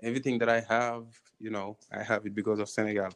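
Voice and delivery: ordinary talk, nothing marked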